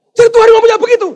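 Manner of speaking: high, loud angry warning; furious ranting. Acted. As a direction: rage at the messenger. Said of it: "Ngamuk orang Kristennya".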